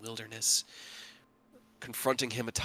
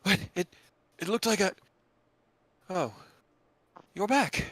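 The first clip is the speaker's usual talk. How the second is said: raised, startled voice; surprised; 8 semitones higher, 5 dB louder than usual. Acted. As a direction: caught off guard, then recognizing. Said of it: "And Emmeline goes".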